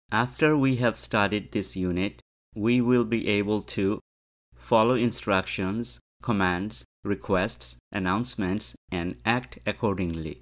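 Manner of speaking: very slow, clear English read-aloud; slow teacher dictation; slow model reading. Shown as a demonstration. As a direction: read each phrase slowly for learners to follow. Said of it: "teacher reads English model slowly".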